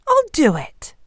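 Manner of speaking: miller agreeing. A narrator's reading. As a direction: agree to the deal in character.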